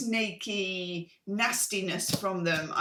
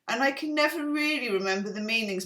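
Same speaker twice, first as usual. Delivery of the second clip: ordinary delivery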